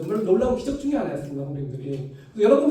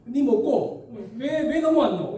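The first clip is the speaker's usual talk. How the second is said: slow Gyeongsang girl's scolding, lightly voiced; a childhood deskmate's Gyeongsang complaint; mild Gyeongsang dialect; 3 semitones higher, slower than usual. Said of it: "거기 사투리로 조금 말씀드리면".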